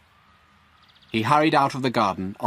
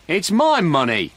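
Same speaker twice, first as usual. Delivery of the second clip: loud, slow protest; shout; 8 semitones higher, 6 dB louder than usual. A narrator's reading. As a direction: shout it, clinging to the money. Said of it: "shouted Frank".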